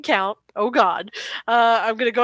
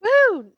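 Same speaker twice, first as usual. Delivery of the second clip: high "woo" of delight; celebratory whoop; 11 semitones higher, 4 dB louder than usual. Real, not acted. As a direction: cheer a lucky roll. Celebrates a good roll.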